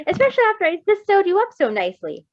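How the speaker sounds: doctor's mock-stern interjection. A narrator's reading. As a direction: scold him mildly from afar. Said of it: "with a stern voice".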